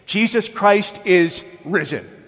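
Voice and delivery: slow, high, loud proclaimed cry; the grieving pastor screams it out to her congregation; urgent scream. Retold or imitated. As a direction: scream out Jesus Christ is risen with urgency. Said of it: "screamed out these words with an urgency".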